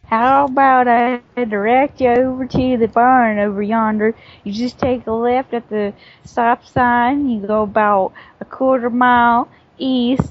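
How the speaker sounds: folksy southern direction-giving; southern accent. Shown as a demonstration. Showing how locals give directions to the barn, southern accent. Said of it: "That's how we give instructions".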